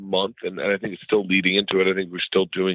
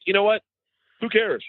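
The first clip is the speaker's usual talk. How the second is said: cheerful high encouragement; 11 semitones higher than usual. Retold or imitated. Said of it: "So I'm like".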